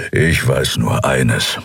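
low, slow growl; low slow grumbling. A narrator's reading. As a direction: growl it low behind her. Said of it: "hörte sie ihn hinter sich knurren".

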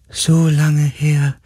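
slow, high, drawn-out spirit. A narrator's reading. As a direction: let the words drift out like a distant being. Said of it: "sagte die Stimme". (gedehnte, hohe Geisterstimme)

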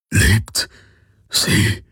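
halting, whispered question; barely a murmur. A narrator's reading. Wounded Hawks asking whether she lives, murmuring. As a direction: breathe it out weakly, with a gap between words. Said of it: "kaum mehr ein Raunen".

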